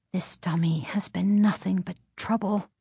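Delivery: hushed, breathy inner voice; whispered thought. A narrator's reading. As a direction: whisper it to herself. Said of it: "Chris thought".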